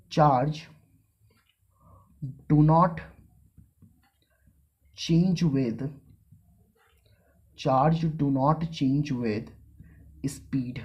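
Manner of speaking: slow, spaced-out dictation pace; teacher dictating to the class; dictating notes. Shown as a demonstration. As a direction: read it out word by word for students to copy.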